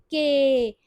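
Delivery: के as one long, held ए; long vowel. Shown as a demonstration. Teacher showing how we pronounce it, के drawn out. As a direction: stretch के slowly without a separate ई. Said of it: "हम इसको कैसे pronounce करेंगे".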